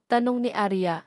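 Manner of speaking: usual speaking voice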